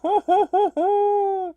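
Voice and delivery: loud, high villain laugh; gloating cackle. Acted. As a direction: cackle loudly at your victim. Villain gloats over a lower intelligence.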